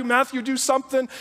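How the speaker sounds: mock yell. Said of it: "They're yelling at Matthew".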